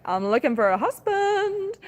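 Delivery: high, slow flirty cow voice; high playful voice for the heifer Apollo; voicing the cow. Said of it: "and Apollo was like".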